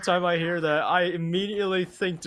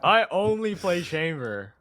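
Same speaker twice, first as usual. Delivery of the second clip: slow, laughing French-tinged Chamber voice. Pretend. Caster mimicking the agent Chamber for his co-caster. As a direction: drawl it in a put-on Chamber accent. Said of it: "promise to his voice actor".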